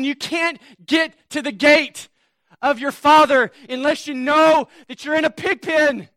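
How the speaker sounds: loud, high preaching at full force; fiery preaching. Acted. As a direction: drive it home to the congregation.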